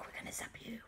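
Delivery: barely voiced, very soft warning; whispered threat. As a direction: whisper it like a secret attack. First hushed take of threat.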